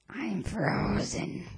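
slow breathy whisper. Pretend. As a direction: whisper it slowly, frozen stiff. Spooked by ghost.

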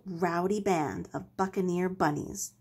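ordinary delivery, nothing marked

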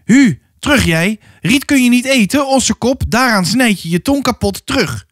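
shouting at cattle. A narrator's reading. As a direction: drive a stubborn ox back with your voice. Drover drives a stubborn ox back. (een os terugjagen, hoog geroepen)

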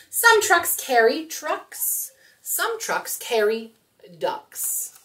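slow, bright read-aloud for little ones; reading to children. Acted. Storytime host reads a truck book to children. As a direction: read it slowly to small kids.

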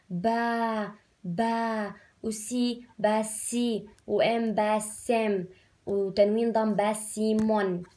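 slow, stretched spelling; drawn-out spelling. Shown as a demonstration. Teacher telling pupils to stretch the sounds with her. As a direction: stretch each piece out with them. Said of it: "مطوا معي".

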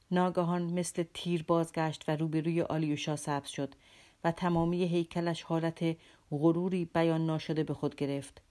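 ordinary delivery, nothing marked

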